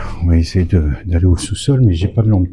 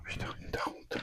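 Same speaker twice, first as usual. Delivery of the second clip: whispering; 22 dB quieter than usual. Real, not acted. Tense exploration, whispered curse.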